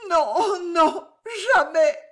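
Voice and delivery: tearful refusal. Acted. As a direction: refuse through tears.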